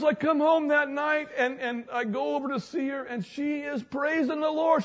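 amazed man. Retold or imitated. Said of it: "He goes".

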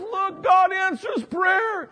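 triumphant wife. Retold or imitated. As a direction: proclaim it in triumph. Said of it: "She goes".

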